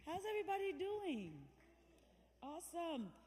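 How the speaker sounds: loud, high call to the crowd; hyped greeting to the audience. Real, not acted. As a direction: rev up the room with a big hello.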